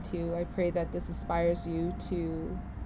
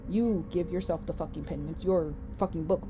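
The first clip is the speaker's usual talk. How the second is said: ordinary delivery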